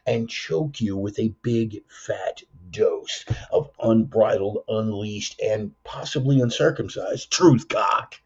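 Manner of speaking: low, slow, menacing intro voice; deep announcer voice. Acted. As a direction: growl out the show opener.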